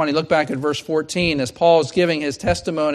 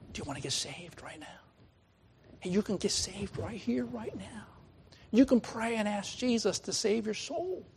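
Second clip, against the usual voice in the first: gentle invitation; 4 semitones higher, 11 dB quieter than usual. Pretend.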